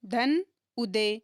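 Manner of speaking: धन उदय drawn out very slowly; teacher slowly breaking Bhagyoday into parts; slow split. Shown as a demonstration. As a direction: spell out the join, unhurried. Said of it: "splitting Bhagyoday into parts".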